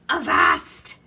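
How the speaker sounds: loud, rough pirate yell; Braidbeard yelling. A narrator's reading. Pirate captain spotting baby with map, loud yell. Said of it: "yelled Braidbeard".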